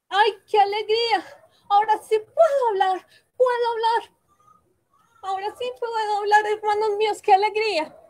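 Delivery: high, bright cries of joy; overjoyed. Acted. Princess rejoicing that she can speak again. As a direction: burst out with relief at speaking.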